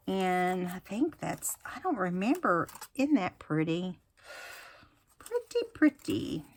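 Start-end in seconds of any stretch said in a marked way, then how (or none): 5.2-6.4 cooing it in a high voice; light, high admiring coo; sing-song high. Real, not acted.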